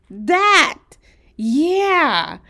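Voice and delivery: very loud, high cry of recognition; amused outburst. A narrator's reading. Sarah recognizes the boy's theatrical face, loud and delighted. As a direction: burst out with delighted agreement. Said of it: "Sarah laughed".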